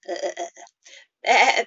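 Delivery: slow, loud wavering uh bleat; slow sheep bleat. Shown as a demonstration. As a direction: stretch each bleat slowly.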